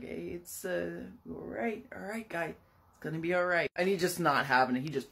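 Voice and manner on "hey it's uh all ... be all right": calm him down gently; gentle. Retold or imitated. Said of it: "gently speaks to Philip".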